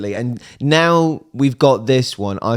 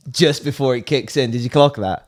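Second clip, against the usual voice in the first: ordinary talk, nothing marked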